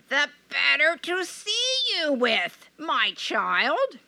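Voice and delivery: high sweet false granny voice; sugary old granny hiding a wolf; a wolf putting on a sweet granny voice; wolf as grandmother. Acted.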